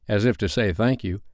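ordinary delivery

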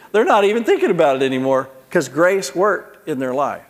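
usual speaking voice

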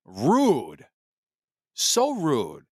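mock offended. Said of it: "I was like".

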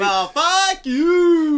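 high, loud, very drawn-out; mock outraged retort. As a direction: stretch it into a hammed-up yell. Acting out a retort to stiffing.

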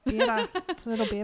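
laughing Australian attempt; bad Australian accent. Co-host copies her friend's attempt, laughing Australian accent. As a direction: echo a clumsy Australian vowel through laughter. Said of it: "Sorry, that's horrible".

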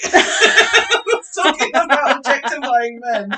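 helpless laughter breaking into the words, loud and drawn out; laughing. Real, not acted. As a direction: talk through real giggles at your own slip. Cracking up at own slip.

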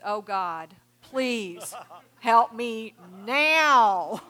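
loud, slow and dragged-out cry for help; desperate flare prayer. Shown as a demonstration. Counselor acts out a panicked prayer, loud and slow, half joking. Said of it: "a flare prayer".

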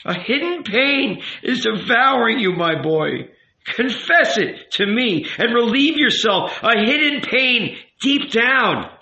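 high, impassioned rabbi voice; urgent, pleading rabbi. A narrator's reading. Rabbi urging the youth to confess, impassioned. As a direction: implore him to unburden himself.

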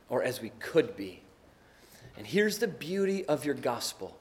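hushed, reverent closing prayer. Real, not acted. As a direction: pray humbly, with long pauses between phrases.

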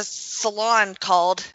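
normal talking voice

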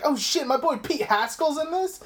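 high-pitched mock fanboy thrill; teasing impression of an excited fan; imitated excited friend. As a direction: act out a friend's delighted surprise. Imitating excited friend during the movie.

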